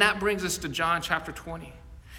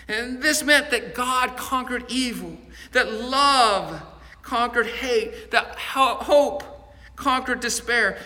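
preaching; 3 semitones higher than usual. Acted. Pastor preaching the resurrection to his congregation, building. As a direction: preach it to a climax.

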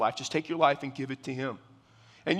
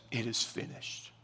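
a breathless, voiceless whisper, much softer; whispered last words; 6 semitones lower, 11 dB quieter than usual. Acted. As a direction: breathe it out like a dying man's final words. Dying Jesus's last words quoted.

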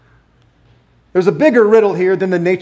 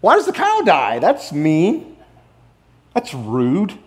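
naive objector; 3 semitones higher, slower than usual. Pretend. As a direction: voice a childish objection.

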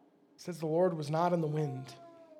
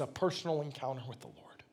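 dropped low and softer into a whisper; preacher whispering the key phrase; 4 semitones lower, 7 dB quieter, faster than usual. Real, not acted. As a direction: hush it to draw them in.

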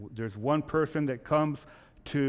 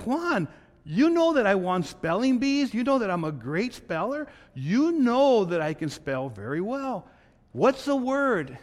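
boastful wife; 7 semitones higher than usual. Acted. Chelo boasting her spelling.

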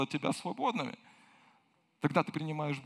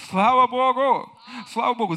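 joyful praise shout; 6 semitones higher, 10 dB louder than usual. Real, not acted. Preacher shouting praise to the congregation, jubilant and loud. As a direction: shout it out to the church in joy.

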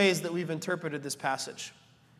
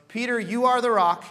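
louder, higher, stress on are; emphatic quote; 5 semitones higher, 8 dB louder than usual. Retold or imitated. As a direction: declare it firmly. Quoting Jesus, emphatic.

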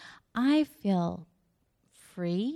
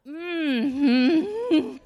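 high, loud, drawn-out knowing hum; exaggerated mock "mm-hmm"; skeptical mm-hmm; 5 semitones higher, 7 dB louder than usual. Wife answers her irritated husband, with a skeptical hum.

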